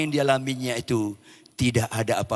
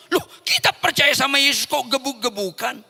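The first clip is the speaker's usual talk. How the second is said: high, loud imitated disbelief; astonished protest; 7 dB louder than usual. Captor realizing prisoner shares his faith, loud astonished protest. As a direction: protest in amazement.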